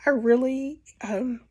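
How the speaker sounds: blocked nose, nasal-sounding talk; stuffy nose. Real, not acted. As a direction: speak through a stuffy nose. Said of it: "You could probably hear it".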